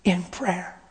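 slow, choked whisper. Retold or imitated. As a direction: breathe it out slowly, fighting tears. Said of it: "She said".

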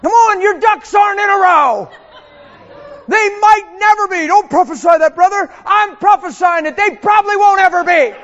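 full-force preacher yell; shouting sermon. Acted. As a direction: shout it at the whole room.

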